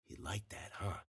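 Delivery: very soft, low taunt; whispered. A narrator's reading. Narrator taunts his rival cheek to cheek, whispered. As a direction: whisper it close into his ear. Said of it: "and whispered".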